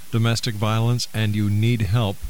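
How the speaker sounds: ordinary delivery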